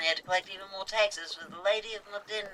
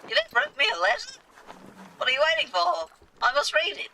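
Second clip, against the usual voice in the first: high, louder eager outburst; snatch at the news, overjoyed; thrilled captain; 10 semitones higher, 4 dB louder than usual. Acted. Captain hears Yvette wrote him, suddenly thrilled and eager.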